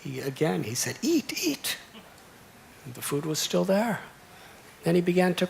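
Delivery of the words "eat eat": put-on little boy pleading. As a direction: coax gently in a tiny high child's voice. Boy urges deity again.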